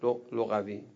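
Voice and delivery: ordinary talk, nothing marked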